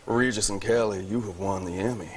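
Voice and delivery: a relaxed Southern American accent; Bill's Southern accent. Shown as a demonstration. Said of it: "and I'll do it as Bill".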